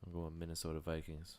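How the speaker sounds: low, soft mumble; barely audible pick; mumbled. Real, not acted. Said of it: "Can you speak up".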